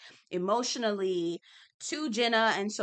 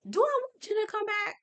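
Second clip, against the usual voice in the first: high mock question; 12 semitones higher, faster than usual. Rhetorical self-question.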